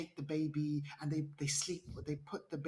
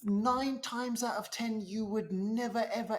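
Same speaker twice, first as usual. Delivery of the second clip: a touch of incredulous emphasis; emphatic insistence; 4 semitones higher than usual. Real, not acted. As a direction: hammer it home, never ever. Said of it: "emphatic insistence".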